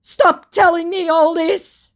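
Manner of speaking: high, loud, slow wail; hammed-up distressed plea; mock crying out. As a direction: wail it like an overwrought child. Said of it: "he cries".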